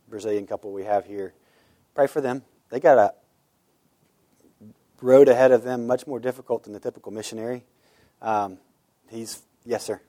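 normal talking voice

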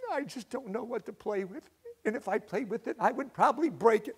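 put-on a timid child who fears breaking things; sad boy voice. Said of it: "And he said".

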